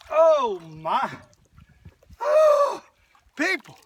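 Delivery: loud, high-flying whoop of amazement; excited exclamation. Real, not acted. Angler landing a big fish, exclaiming to viewers in amazement. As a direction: cry out in real astonishment.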